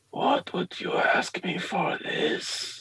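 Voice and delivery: slow, drawn-out whispered question; whispering priest. Acted. Priest asks the peddler his price, slow whispered voice. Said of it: "before saying".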